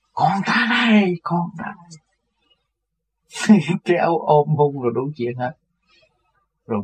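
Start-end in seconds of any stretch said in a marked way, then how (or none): 0.1-1.2 high, slow, drawn-out joyful call; excited calling out. Retold or imitated. Old man greets his spiritual son, high joyful call. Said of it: "ổng ở trên lầu xuống, chạy xuống". 1.2-2.0 the repeat sinks softer and trails off; softer echo. Retold or imitated. Old man repeats his greeting, fading softly. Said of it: "ổng ở trên lầu xuống, chạy xuống".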